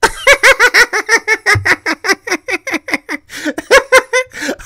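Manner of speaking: a burst of high laughter that falls away; real high laugh. Real, not acted. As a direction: break into high, incredulous laughter. Laughing at her request.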